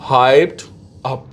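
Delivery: "Hyped up" said as HIPED-up with the stress on hyped; pronunciation demo. Shown as a demonstration. Teacher shows students how to pronounce hyped up. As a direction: model "Hyped up" slowly for the class. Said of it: "pronunciation क्या बनती है?".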